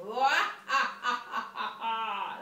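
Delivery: loud, high mocking laughter; roaring laugh. Lions pointing and laughing loudly at Gerald. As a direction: cackle at Gerald. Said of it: "lions are pointing and saying".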